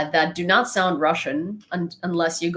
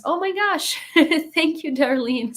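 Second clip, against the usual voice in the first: much higher, a sudden delighted squeal; delighted surprise; 10 semitones higher than usual. Real, not acted. She squeals at a viewer's cute hedgehog gift with delight.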